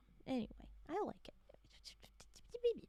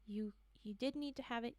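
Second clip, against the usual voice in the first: normal talking voice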